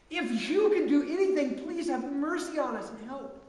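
a father pleading with Jesus for his son. Acted. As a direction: beg like a father out of options. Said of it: "can't you just feel the dad's heart here".

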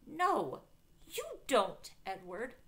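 high, loud contradiction; firm retort. A narrator's reading. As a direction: cut him off insistently. Arguing back at him.

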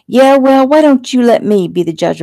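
loud, high burst; angry retort. A narrator's reading. Fiancée cuts off his excuse about marriage, angry. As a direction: cut in on him hotly. Fiancée snaps back in quarrel.

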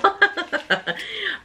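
soft high laugh of disbelief; breathy delighted laugh. Real, not acted. Collector overwhelmed by the last pins, soft disbelieving laugh.

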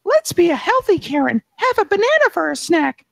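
high, sweet little angel voice; shoulder angel voice. Acted. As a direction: chirp it like a goody-goody angel. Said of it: "The mini Karen angel on my shoulder says".

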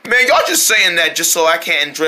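usual speaking voice

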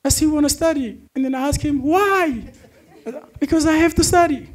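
high-pitched mock voice of the friend; high squeaky mocking voice. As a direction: imitate the eager student. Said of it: "the guy was like".

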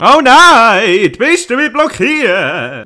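loud high hammed-up panic; mock panic. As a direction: cry out in fake alarm.

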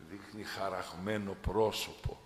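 ordinary delivery

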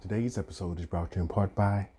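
soft bedtime story voice. Acted. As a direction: read it like a lullaby intro. Redoes intro in soft voice.

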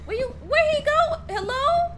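loud high call for a lost friend. Real, not acted. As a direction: call out across the map. Lost player calling out.

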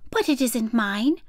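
lightly voiced curious little girl. A narrator's reading. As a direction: pipe up high, then puzzle over it.

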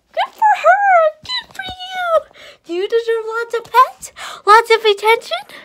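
very high, loud squeal of praise; over-the-top doting on a pet; gushing pet talk. Real, not acted. Gushing praise to her dog.

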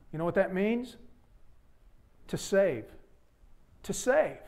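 normal talking voice

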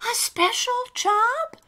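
very high, hopeful question; amazed little character. Acted. Alistair hears of a special job, amazed. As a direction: squeak it in wonder.

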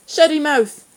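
high, loud, curt snap; surly boy's sharp order; short and rude. A narrator's reading. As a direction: cut them off rudely. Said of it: "the boy said shortly".

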